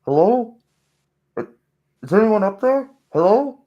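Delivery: high, loud, muffled from below; calling up through the floor. Acted. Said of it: "a muffled voice".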